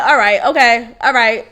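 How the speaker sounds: mildly agitated, low-pitched acting; agitated, curt. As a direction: imitate yourself dismissively agreeing while irritated. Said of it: "I'm sounding agitated".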